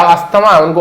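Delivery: usual speaking voice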